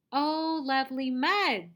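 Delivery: high, loud storybook exclamation; reading like a teacher. Shown as a demonstration. Teacher reading the pig's line, loud high read-aloud voice. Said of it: "read it like a teacher".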